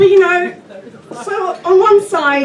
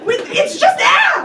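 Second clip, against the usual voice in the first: comic shout; 12 semitones higher than usual. Acted. Comic ranting at English bread, exasperated shout. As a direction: explode at the bread, mock fury.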